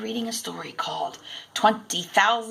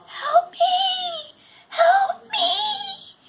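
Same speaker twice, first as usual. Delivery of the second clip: high, desperate distant cry; faint cry for help; much slower than usual. A narrator's reading. Gulliver tied down, crying for help from afar. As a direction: cry out from far away. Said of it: "a faint voice being carried".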